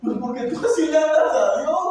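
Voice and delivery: high, loud retort of an imitated child; child answering back. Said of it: "Y el niño le dice". (réplica de niño imitado, aguda y fuerte)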